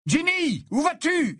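appel aigu, surpris; crie-lui de loin; appel écrié. A narrator's reading. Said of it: "s'écria Harry". (Harry appelle Ginny qui file, voix aiguë)

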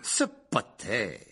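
drawn-out contemptuous aunt voice; piercing aunt. A narrator's reading. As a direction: drag the name out with disdain. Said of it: "La voix de la tante Marge semblait lui vriller les tympans". (voix de tante méprisante, traînante)

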